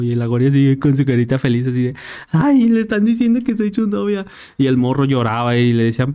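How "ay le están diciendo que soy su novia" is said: voz mucho más aguda, soñadora; niña ilusionada. Retold or imitated. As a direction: ilusionada como niña enamorada. La niña ilusionada por el rumor. (niña emocionada y aguda)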